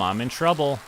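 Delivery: usual speaking voice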